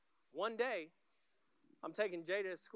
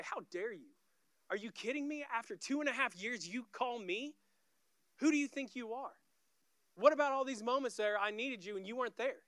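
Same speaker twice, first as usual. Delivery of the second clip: raised-pitch, mounting offended rant; acted indignation; 5 semitones higher, 4 dB louder than usual. Offended friend answers a call after years, acted indignation. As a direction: fire off affronted questions, getting louder. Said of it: "Love doesn't take an offense and say".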